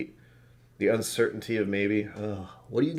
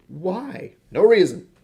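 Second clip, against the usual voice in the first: mock winter solstice; 11 semitones higher, 10 dB louder, slower than usual. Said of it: "It's just like".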